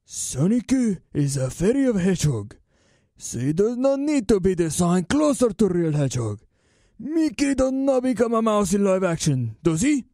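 put-on Japanese accent. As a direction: imitate a Japanese artist with a heavy accent. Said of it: "I did that with a Japanese accent".

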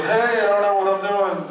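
high, sheepish admission; a light touch of lost gringo; mock helpless plea; clueless dancer. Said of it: "I was like".

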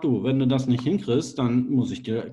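ordinary talk

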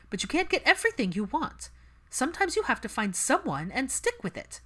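a pragmatic mother pressing her daughter; mother urging her daughter to settle down, pitched high; mother's voice. Acted.

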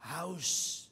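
normal talking voice

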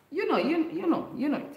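high, sing-song you know; teasing knowing hint. Real, not acted. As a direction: wink at the audience, you know it.

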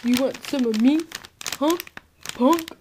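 tough-guy taunt, rising to "Punk?"; mean voice. Pretend. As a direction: puppet acting mean and tough. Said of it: "That's my mean".

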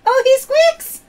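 high, loud excited cry; host delighted by a squeaky duck toy; delighted squeal. Real, not acted. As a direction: squeal with surprise.

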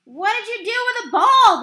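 high, loud angry scream. A narrator's reading. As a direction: scream the accusation. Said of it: "the pitcher screamed at the catcher".

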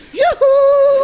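shrill high sing-song call, loud; yoo-hoo up to a man on a ladder. Retold or imitated. Mum calls up to the aerial fella, loud and high.

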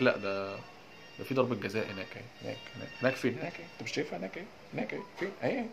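hushed, bewildered back-and-forth; softly insist, then question in confusion; mock VAR exchange. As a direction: act out a baffled exchange under the breath. Acts out baffling VAR penalty exchange.

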